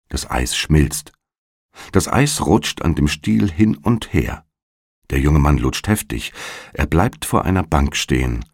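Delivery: ordinary delivery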